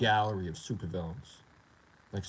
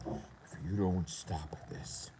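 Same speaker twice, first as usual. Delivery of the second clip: slow whispered threat; menacing whisper; 5 semitones lower, 9 dB quieter, much slower than usual. Retold or imitated. Angry player threatening him online, menacing whisper. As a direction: imitate a threat in a drawn-out whisper.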